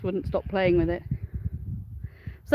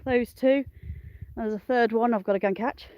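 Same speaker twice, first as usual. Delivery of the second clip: normal talking voice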